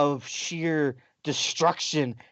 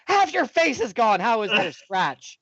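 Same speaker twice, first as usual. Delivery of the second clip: incredulous; 7 semitones higher, 3 dB louder than usual. Retold or imitated. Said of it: "He's like".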